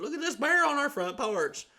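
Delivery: very high, silly put-on voice; mocking gushing posters. People showing off on Facebook, high mocking gush. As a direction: mimic excited braggers in a high pitch.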